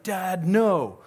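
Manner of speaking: put-on child yelling. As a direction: shout it like a defiant kid. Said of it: "if you yell".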